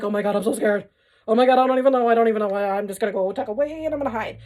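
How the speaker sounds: imitating a scared partner, loud, rushing to the end; hammed-up fright, ready to run and hide; mock panic. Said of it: "masculine's like".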